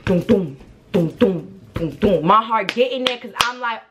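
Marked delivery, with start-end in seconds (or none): 0.1-2.3 thudding double-beat doom-doom; spoken heartbeat in paired thumps; imitated heartbeat. Storyteller mimics their heart starting to pound, thudding doom-doom. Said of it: "So my heart start".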